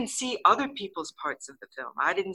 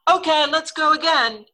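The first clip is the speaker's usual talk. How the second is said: high, loud imitation of a director; director calling out; 10 semitones higher, 8 dB louder than usual. Director ordering another take after the breakage, called out loudly. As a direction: call it to the whole set.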